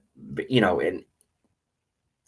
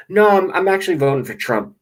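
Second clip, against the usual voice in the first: higher, louder mock-polite voice; mock cheery reply; 9 semitones higher, 5 dB louder than usual. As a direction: sound brightly innocent. Acted exchange with stranger.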